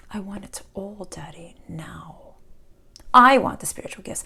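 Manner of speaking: put-on whispered childish demand; spoiled child whisper. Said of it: "Or are you the other child?".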